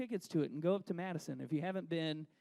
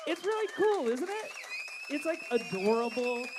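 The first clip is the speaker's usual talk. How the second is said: much higher, bright enthusiasm; gushing excitedly; 5 semitones higher, 6 dB louder than usual. Real, not acted. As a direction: gush about the town. Gushing about Madison.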